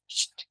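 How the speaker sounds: unvoiced "Tch, tch" like scaring off a cat; shooing a cat. Shown as a demonstration. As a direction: hiss "Tch, tch" to shoo a cat. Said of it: "Sai gato".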